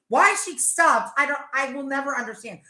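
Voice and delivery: ordinary delivery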